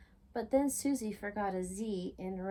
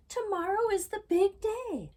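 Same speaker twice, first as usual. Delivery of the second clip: 1 excited; 11 semitones higher, 5 dB louder than usual. A narrator's reading. Excited Lizzie before contest.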